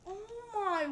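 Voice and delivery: high, loud stammering amazement; stunned disbelief. Real, not acted. Stunned that target survived.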